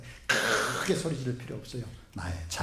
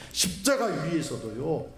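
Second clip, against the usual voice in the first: normal talking voice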